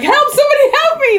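high, loud, fast mock scream for help; cry for help. Woman dragged off by kidnapper, mock scream for help. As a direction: shriek it out, half joking.

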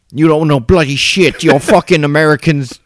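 high, loud imitation of an irate Brit; pissed-off British accent. Host mimics a British ex-coworker's Facebook reply, angry British accent. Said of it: "pissed off British".

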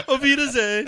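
high, laughing Auf Wiedersehen; laughing German goodbye. Retold or imitated.

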